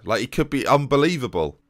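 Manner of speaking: ordinary talk, nothing marked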